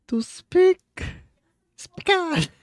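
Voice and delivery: high, loud burst; straining to recall. Real, not acted. Learner straining for the Spanish word, high blurt.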